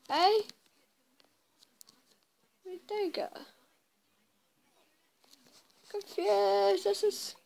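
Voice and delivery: loud, high, bewildered; confused. Real, not acted. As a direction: shout in baffled confusion. Confused after chase escape.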